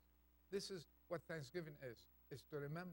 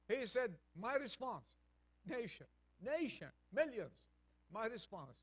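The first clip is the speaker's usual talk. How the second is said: higher, louder preaching at full force; fervent preaching; 6 semitones higher, 6 dB louder than usual. Acted. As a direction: rouse the congregation.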